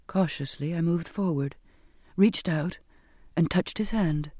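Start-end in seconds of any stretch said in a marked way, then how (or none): none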